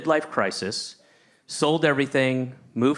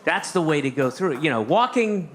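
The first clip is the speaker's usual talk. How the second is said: usual speaking voice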